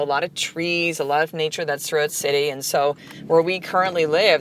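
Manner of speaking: ordinary talk, nothing marked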